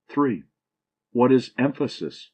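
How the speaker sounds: ordinary talk, nothing marked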